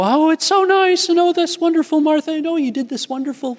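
high, gushing guests fawning over the hostess; dinner guests praising Martha, high and gushing. Retold or imitated. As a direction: gush like delighted dinner guests. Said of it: "people are gonna go".

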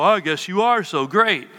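lightly put-on gullible follower voice; mock admiration. Preacher imitating followers who accept airs, mock-impressed. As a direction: give in to the boaster in mock awe.

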